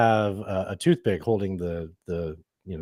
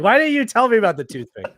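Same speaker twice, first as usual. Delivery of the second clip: much higher and louder accusing yell; yelling at her; 11 semitones higher, 6 dB louder than usual. Retold or imitated. Husband yelling at wife about toothpick, loud accusing. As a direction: blow up at her for not warning you. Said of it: "and then I yell at her".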